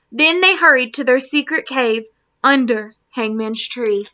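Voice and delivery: storybook read-aloud switching Spanish and English; reading to children. Acted. Teacher reading Peter Pan aloud to her class, gentle storytelling. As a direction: read it like a bedtime story to kids.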